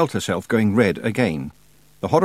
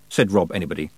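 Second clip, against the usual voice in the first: ordinary delivery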